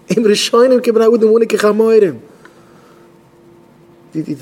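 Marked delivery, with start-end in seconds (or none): 0.1-2.2 loud, high-pitched preaching; impassioned outcry. Acted. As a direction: call it out at full voice. Preacher crying out to listeners.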